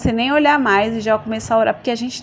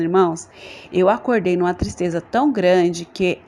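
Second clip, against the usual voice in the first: ordinary talk, nothing marked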